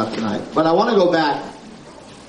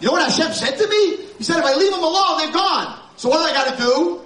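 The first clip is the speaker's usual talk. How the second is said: urgent Moshe; 11 semitones higher than usual. Acted. Rabbi voicing Moshe's urgent resolve.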